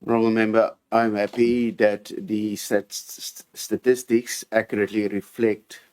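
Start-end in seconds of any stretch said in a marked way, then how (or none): none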